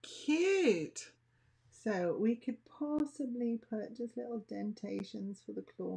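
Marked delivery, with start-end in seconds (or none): 0.0-1.1 squealed high and a bit louder, falling off; delighted cute. Real, not acted. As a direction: coo over the finished paw like it's adorable. Admiring the finished paw.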